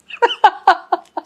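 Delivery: short high laugh; fond high laugh; real laugh. Real, not acted. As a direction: laugh fondly at the memory. Laughing while recalling.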